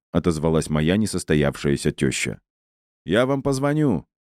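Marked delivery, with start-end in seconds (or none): 3.0-4.1 light, lifted parting call; calling after her. Acted. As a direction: call after someone walking away. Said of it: "крикнул ей вслед".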